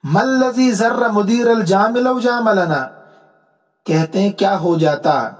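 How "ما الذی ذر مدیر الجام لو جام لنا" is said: slow, measured reading of an Arabic couplet; the teacher reads the poet's couplet aloud for the class, slowly; slow verse recitation. Shown as a demonstration.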